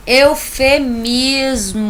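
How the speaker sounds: slow, loud singsong announcement; drawn out. Shown as a demonstration. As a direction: announce the term loudly, dragging every syllable. Teacher dramatically announces the next term.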